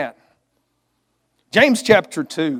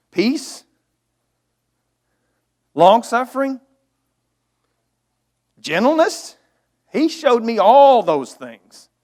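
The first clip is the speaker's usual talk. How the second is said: higher, louder fervent preaching; preaching at full force; 5 semitones higher, 6 dB louder than usual. Acted. Preacher driving home the fruit of the Spirit, fervent. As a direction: lift it up like a revival preacher.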